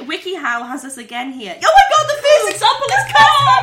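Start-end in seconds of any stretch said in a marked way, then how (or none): 1.6-3.6 high, loud shriek of delight; laughing excitement. Real, not acted. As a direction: cry out in hilarity, laughing. Hilarity at the wiki's example.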